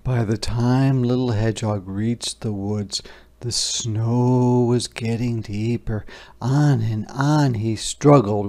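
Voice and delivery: plain reading voice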